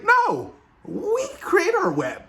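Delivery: very high, squeaky imitation; high mock reply. Spider-Men answering about their webs, in a high mock voice. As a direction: put on a high voice for the retold answer. Acting out the others' puzzled reply.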